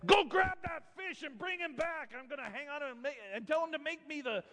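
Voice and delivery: high, strained, softened tyrant screech; drunk with power. Acted. Queen orders husband to fetch the fish, high, strained command. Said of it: "she's drunk with power. She says".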